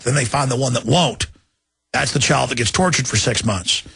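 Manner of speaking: ordinary talk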